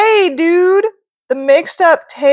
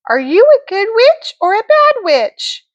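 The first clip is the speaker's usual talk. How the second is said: high, sweet fairy voice; sing-song good witch; Glenda the good witch; 10 semitones higher than usual. Acted. Reads Glenda's lines.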